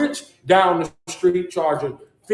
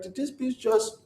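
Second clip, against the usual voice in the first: shocked disbelief; 6 semitones higher than usual. Retold or imitated. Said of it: "I be like".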